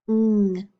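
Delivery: NG as one held nasal hum; isolated letter sound. Shown as a demonstration. Teacher drilling sound cards, NG held alone. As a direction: hum the end of ring, NG. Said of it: "do our letter sound cards now".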